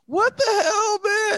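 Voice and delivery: high, loud outburst of disbelief; shocked. Real, not acted. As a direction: blurt it out, stunned. Guest has walked off the call.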